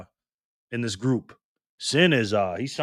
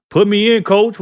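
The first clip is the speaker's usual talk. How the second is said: excited call; 11 semitones higher, 12 dB louder than usual. Acted. Streamer shouting out a viewer line.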